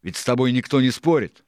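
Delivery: loud, high defensive retort; Israel, protesting. A narrator's reading.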